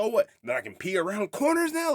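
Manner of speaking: high, loud mock outrage; super mad. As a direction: imitate a guy indignantly losing it. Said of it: "he's all super mad about everybody".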